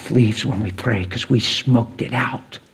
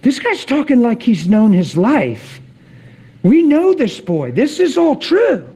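much higher, astonished voice; amazed congregation; 10 semitones higher than usual. Retold or imitated. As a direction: gasp it out as the stunned congregation. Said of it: "they're like".

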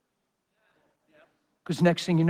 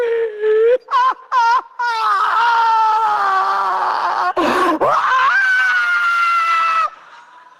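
long high hammed-up blubbering wail; loud mock cry-laugh; mock wailing sob-laugh; 8 dB louder than usual. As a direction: wail like a mushball, overdone. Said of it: "I'd have been a mushball".

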